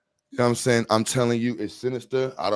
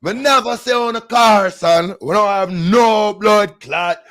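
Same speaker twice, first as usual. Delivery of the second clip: high, loud Jamaican patois; mock Jamaican accent; 10 semitones higher, 9 dB louder, slower than usual. Uninsured driver arguing after a crash, loud mock Jamaican accent. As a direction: stretch it out in a hammed-up Jamaican accent. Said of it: "Then the Jamaican ones".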